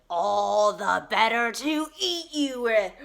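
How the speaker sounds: loud, slow predatory threat; menacing wolf. Acted. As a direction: boom out each word as you pounce. Wolf reveals himself, attacks.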